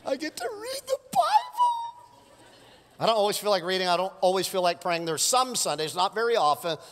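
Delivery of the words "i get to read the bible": high, squeaky mock-gushing praise; sing-song sarcastic delight; mock overjoyed. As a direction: gush like a fake super-saint. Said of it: "I'm like".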